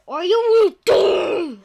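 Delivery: loud slow dramatic warning; shouted warning. Real, not acted. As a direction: yell the threat slowly.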